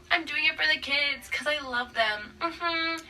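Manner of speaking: mock-innocent, slowing on love them; a hint of a sickly-sweet liar; fake sweet mother. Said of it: "whole time being all".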